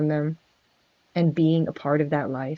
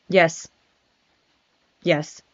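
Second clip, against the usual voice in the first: normal talking voice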